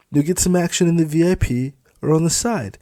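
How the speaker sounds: high, loud, leering; leering sing-song on lucky; smug supervisor. Acted. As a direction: tease the girls with a wink. Felix explains the job.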